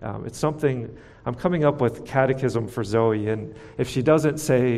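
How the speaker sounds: ordinary talk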